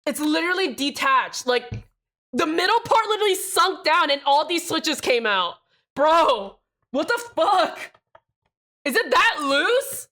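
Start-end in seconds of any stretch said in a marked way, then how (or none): 2.3-10.1 real shock. Real, not acted. Switches popping out live.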